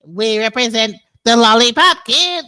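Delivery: loud, put-on lollipop-kid chant; mock lollipop-kid voice. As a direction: mimic his odd voice, loudly. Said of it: "does his voice really sound like this".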